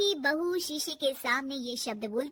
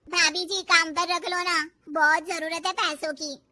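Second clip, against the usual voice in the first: loud, pleading maid; a hint of a pushy housemaid; lightly voiced maid; 8 dB louder than usual. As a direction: ask for work in a loud, needy way.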